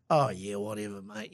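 low, trailing-off Aussie cabbie; softly dismissive imitated Australian driver. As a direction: brush it off in an Australian accent. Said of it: "Typical Australian, um, cab driver".

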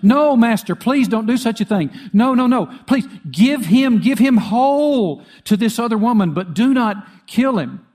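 high, loud anguished begging; desperate pleading cry. Retold or imitated. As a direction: plead for the baby's life, frantic. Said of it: "the real mother cried out".

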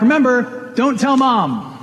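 dad shouting a secret from the crane; retold yell back. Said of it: "And then he'd yell back".

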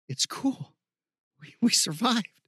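high, halting, winded-sounding reassurance; relieved friend. Acted. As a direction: reassure a friend after the battle, pitch up. Friend reassures exhausted hero.

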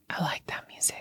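put-on soft whispered kid's remark; whispered quote. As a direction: breathe it out softly, like a sleepy kid. Quoting son's casual remark.

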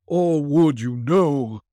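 slow, high mournful ghost; unearthly voice. Acted. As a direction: intone like a chained spirit. Ghost continues.

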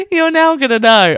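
giggling. Real, not acted. Still laughing after slip.

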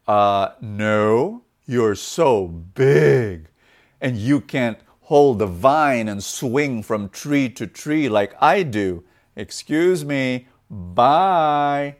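loud, drawn-out brush-off; dismissive monkey. Acted. Monkey rejects the elephant, loud slow dismissive voice. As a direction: stretch the key words in a hammed-up refusal. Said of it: "And the monkey said".

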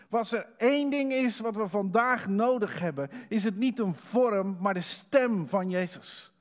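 preaching at full force. Acted. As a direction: drive the point home to the church.

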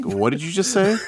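high, incredulous double take; baffled what. Retold or imitated. Friends stunned by her word, asking high and incredulous. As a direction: act floored by what she just said. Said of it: "we all look at her like".

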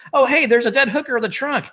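fast, high-pitched hammy discovery; mock surprise. [name] derailing the car commercial, mock surprised announcement. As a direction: blurt it high and quick, playfully shocked.

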